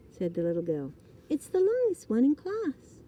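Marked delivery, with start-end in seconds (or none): 1.3-3.0 proud. Acted. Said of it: "she added proudly".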